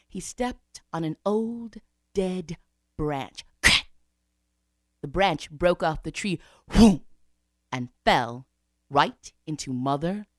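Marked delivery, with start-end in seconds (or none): 3.6-3.9 sudden sharp branch crack; cracking sound. Acted. Monkey steps on dead branch, sharp crack. 6.7-7.0 loud "whoom" burst; falling whoosh. Acted. Branch breaks off the tree, loud whoom.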